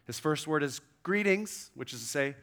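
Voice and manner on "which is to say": ordinary delivery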